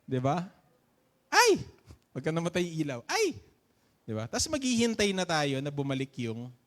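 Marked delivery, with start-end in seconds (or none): 1.3-1.6 sudden high shout when the lights die; startled yelp. Retold or imitated. Preacher imitating people when the power cuts, high startled yelp. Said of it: "sisigaw muna". 3.1-3.3 sudden high shout when the lights die; startled yelp. Retold or imitated. Preacher imitating people when the power cuts, high startled yelp. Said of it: "sisigaw muna".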